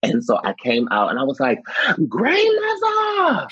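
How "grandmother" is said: very high, long drawn-out call; calling across parking lot. Retold or imitated. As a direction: stretch it out like calling someone far away. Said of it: "I'm screaming across the parking lot".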